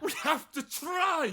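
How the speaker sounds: very high, slow pleading shout. Acted. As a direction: beg at the top of your voice. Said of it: "Stop shouting!".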